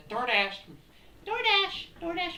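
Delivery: high-pitched, loud mock DoorDash call; creature as delivery driver. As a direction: squeal it out like a goofy monster at the door. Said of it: "did it say hi? Was it like DoorDash?".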